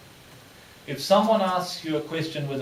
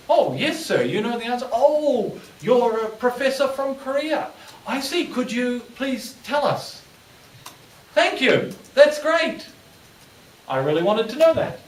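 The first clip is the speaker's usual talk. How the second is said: much higher, bright, thrilled thanks; eager delight; 9 semitones higher, 4 dB louder than usual. Shown as a demonstration. Presenter gushing thanks to the professor from Korea, excited. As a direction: gush with relief that someone knows.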